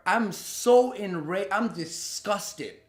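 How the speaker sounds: ordinary delivery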